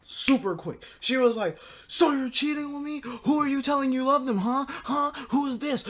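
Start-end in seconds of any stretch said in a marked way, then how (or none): 1.9-5.9 high-pitched mock angry rant; mad girlfriend. Girlfriend confronting boyfriend over a text, mock mad. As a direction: imitate an accusing, high-voiced girlfriend. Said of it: "she went from crying to, like, mad".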